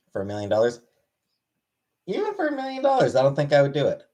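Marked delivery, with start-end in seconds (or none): 2.1-3.2 incredulous. Real, not acted. Shocked refusal, high pitch.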